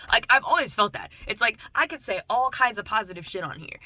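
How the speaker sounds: ordinary talk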